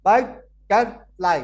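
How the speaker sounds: "but, टर, fly" in three separate beats, a clear pause between; split into syllables. Shown as a demonstration. Teacher showing a three syllable word, "but, टर, fly" split into syllables. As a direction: break "but, टर, fly" apart, punching each piece. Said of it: "three syllable word".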